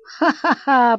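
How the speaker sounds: amused father's laugh; hearty laugh. A narrator's reading. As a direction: laugh out at the boy.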